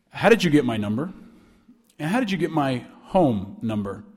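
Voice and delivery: low, stern interrogation; pissed and stern. Retold or imitated. Alum questioning student about his home number, pissed. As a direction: press the caller in a low, pissed voice. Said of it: "He sounded pissed".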